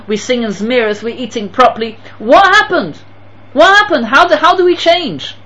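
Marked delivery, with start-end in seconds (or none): 2.2-5.4 high, loud, incredulous outcry; pitched-up preacher's exclamation; rhetorical outcry. Real, not acted. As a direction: cry out in disbelief at the congregation.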